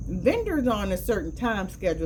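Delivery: ordinary talk, nothing marked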